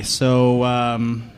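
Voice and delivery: ordinary talk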